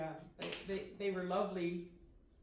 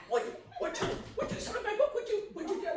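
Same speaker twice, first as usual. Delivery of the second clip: eager fan; 14 semitones higher, 3 dB louder, faster than usual. Retold or imitated. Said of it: "she said".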